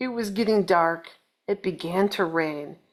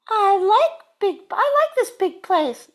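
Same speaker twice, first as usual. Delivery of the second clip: Missus Bird; 11 semitones higher, 5 dB louder than usual. Acted. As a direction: sing it out high like a happy little bird. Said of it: "said Missus Bird".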